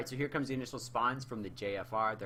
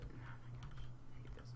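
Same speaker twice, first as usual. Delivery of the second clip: whispering; 9 dB quieter, slower than usual. Real, not acted. Frustrated with the camera.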